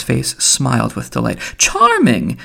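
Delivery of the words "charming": high-pitched, falling sweet exclamation; finical, sweet. A narrator's reading. Mulligan delighted at Stephen's answer, finical and sweet. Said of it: "in a finical, sweet voice".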